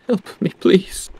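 a hint of a faint, dying plea; high, slow, feeble cry for help; weak pleading voice. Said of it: "a weak voice calls out".

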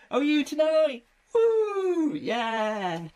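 usual speaking voice

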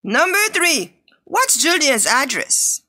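normal talking voice

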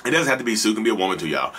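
ordinary talk

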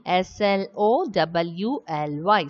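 letter by letter, evenly spaced; spelling out letters. Shown as a demonstration. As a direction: spell each letter out separately. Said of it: "the spelling of the word".

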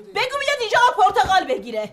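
high-pitched, irritated retort; exasperated outburst. Acted. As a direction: lose your patience and fire back at the child. Mother snaps in an argument.

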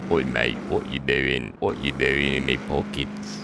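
low, slow British character voice; put-on British bloke.

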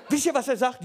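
usual speaking voice

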